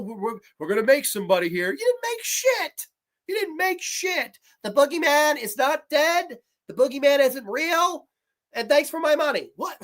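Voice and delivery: normal talking voice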